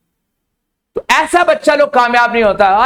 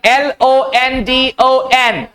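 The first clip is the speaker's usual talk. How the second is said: letters called out one by one, slowly; slow spelling; much slower than usual. Shown as a demonstration. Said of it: "ओ को अक्सर जगह आ पढ़ते हैं". (धीरे धीरे अक्षर बोलते हुए)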